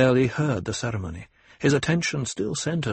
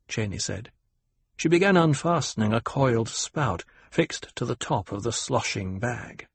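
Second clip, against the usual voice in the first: ordinary delivery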